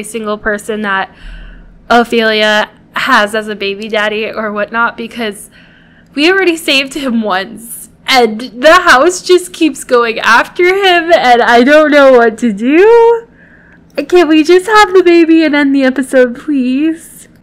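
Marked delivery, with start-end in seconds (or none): 6.1-17.3 exasperated. Real, not acted. Exasperated at the cursed house.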